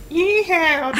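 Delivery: high-pitched mock American cowboy; hammed-up American howdy; American accent. Host playing his American self in self-interview, mock American accent. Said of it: "interviewing American [name]".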